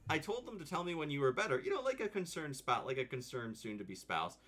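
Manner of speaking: hammed-up concerned fiance; imitating the fiance. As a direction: play the worried husband-to-be, squeaky and fussy. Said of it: "And he's like".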